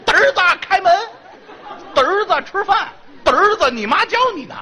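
padre imitato che chiama il figlio, più acuto; mock father calling. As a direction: imita il papà che rientra chiamando 的儿子 ridendo. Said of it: "一进楼道就管你叫的儿子".

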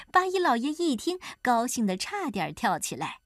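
plain reading voice

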